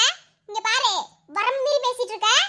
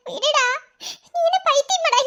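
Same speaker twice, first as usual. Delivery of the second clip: ordinary delivery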